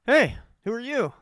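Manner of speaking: high, loud startled demand; startled mom. Retold or imitated. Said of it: "And she goes".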